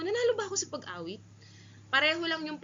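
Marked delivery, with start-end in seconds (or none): 0.0-1.2 Nanalo ba ako sa pag-awit? high and quick with a rise at the end; question tune. Shown as a demonstration. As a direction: ask it. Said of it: "parang tinatanong mo".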